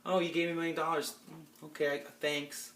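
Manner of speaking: quiet, unimpressed mock thanks; soft, halting, awkward acceptance, lightly imitated; underwhelmed, awkward imitation. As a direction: mimic someone for whom nothing's good enough. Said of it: "She's always like".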